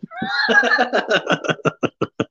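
high-pitched trailing laugh; long squeal of laughter, easing off. Real, not acted. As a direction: giggle away helplessly. Laughs after offering free ringtone.

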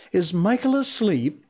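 put-on casual boy voice, pitched well up; careless boy's question. A narrator's reading. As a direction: toss it out high like a boy. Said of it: "he asked with a careless glance".

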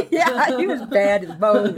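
loud, through real laughter; real laughing delivery; laughing agreement. Real, not acted. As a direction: laugh as you agree, raising your voice. Laughing at her own story's punchline.

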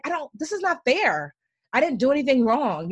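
retold frustrated rant at God. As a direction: vent the unfairness, rising up high. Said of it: "raging 'cause I was like".

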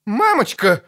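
high-pitched startled cry; alarmed exclamation. A narrator's reading. Bard clutching his throat at the sight outside, alarmed exclamation. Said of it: "воскликнул Лютик, хватаясь за горло".

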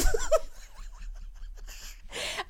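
high, breathless laughing fit; real laugh. Real, not acted. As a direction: crack up at your own retort. Laughs at own retort.

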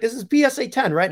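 high-pitched mock Italian accent; hammed-up Italian-accented question. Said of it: "this very nice Italian".

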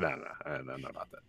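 low, hushed nah-nah refusal; dismissive mumble. Retold or imitated. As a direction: mutter it away, uninterested. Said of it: "And I'm like".

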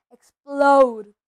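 loud burst on one word; explosive. A narrator's reading. Narrator describes Hagrid near bursting, explosively.

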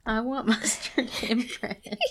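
giggling while repeating the line; laughing. Real, not acted. Reader repeats the sick girl's line to listener, laughing. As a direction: read it through real laughter.

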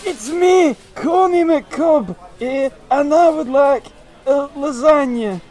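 put-on high wheezy old Yorkshire farmer accent; old Yorkshire farmer, wheezing and high. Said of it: "I was going for, like, a, a wheezing old Yorkshire farmer".

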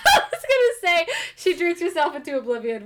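laughing through the words, high; giggling as she talks; amused, laughing out the line. Real, not acted. Laughing while retelling plot.

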